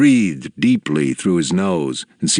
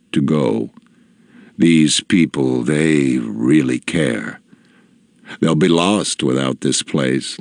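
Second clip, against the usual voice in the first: low, slow, soft elder's voice; a gentle, deep-voiced old sage; soft deep old man; 5 semitones lower, slower than usual. A narrator's reading. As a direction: speak low and unhurried like a wise old leader. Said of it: "His voice was soft and deep".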